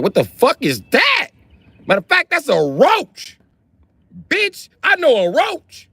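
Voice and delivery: yelling high and startled at a giant bug; shrill-free high alarmed voice, slowing at the end; high-pitched panicked yell. Retold or imitated. To his girl on the street, high alarmed yelling. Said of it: "I'm like".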